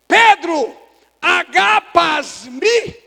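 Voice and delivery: loud, high, stretched-out challenge; impassioned questioning. Acted. Jesus questions Peter in Greek, impassioned shout. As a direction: cry out the question slowly, ending on a rise.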